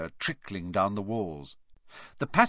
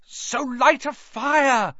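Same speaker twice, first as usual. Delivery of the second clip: slow high strained shout; choked shout; 12 semitones higher, 5 dB louder, slower than usual. Acted. Harry choking out the obvious to Hermione, slow strained shout. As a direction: force it out while choking. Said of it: "Harry choked".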